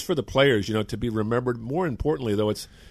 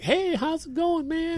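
imitating a high, slow little voice; slower than usual. Ballplayer greeting in a high little voice. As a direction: mimic his tiny high greeting. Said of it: "little high voice".

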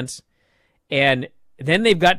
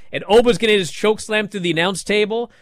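normal talking voice

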